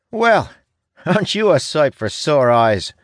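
very high, loud, cheeky greeting; young apprentice. A narrator's reading. Young ranger greeting a returning comrade, high-voiced and brash. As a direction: hail an old comrade in a high, pushed voice.

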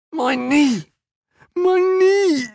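very high cry of pain; agonised wail. A narrator's reading. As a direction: wail it out like an injured fighter. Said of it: "Aram started blubbering".

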